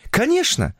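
very high, eager agreement; eager young woman. A narrator's reading. Woman agrees to introduce her lover, high eager voice. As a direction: answer eagerly, pitched far up.

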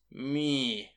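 ordinary delivery

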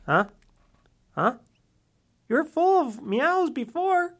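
cooing at the cat to say something; talking to the cat. Real, not acted. Coaxing the cat to speak.

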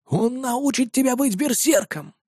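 put-on hushed prompting from an old man; whisper. A narrator's reading. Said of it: "прошептал мне старик". (приглушённый старческий шёпот)

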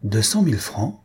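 mildly astonished question, lifted at the end; exclaiming. A narrator's reading. Mother hears of the huge inheritance, exclaiming. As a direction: echo the sum in disbelief. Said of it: "Madame Jujube s'exclama".

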